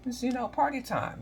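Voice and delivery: high, squeaky disbelieving voice; mocking party people. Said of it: "some people be like".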